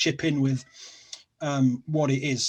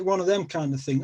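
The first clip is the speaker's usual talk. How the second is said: ordinary talk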